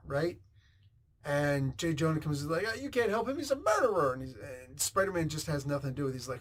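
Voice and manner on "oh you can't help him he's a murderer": high-pitched mock accusation; accusing outcry. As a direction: play an indignant accuser, voice shooting up. Acts out Jonah accusing Spider-Man.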